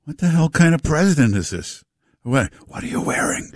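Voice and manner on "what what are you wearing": creepy mock whisper; hushed mocking whisper to the audience. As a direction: whisper it like an obscene call.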